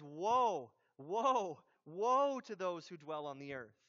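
loud, drawn-out WOE, each one separate; dramatic warning. Acted. Preacher quoting Revelation to show intense woe, dramatic warning. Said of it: "Man, he's so dramatic".

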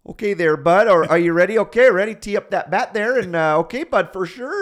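high, loud hammed-up Canadian coach voice; mock the folksy Canadian coach, eh. Mimicking the baseball coach at the pitching machine, thick Canadian accent. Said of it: "the most stereotypical Canadian accent".

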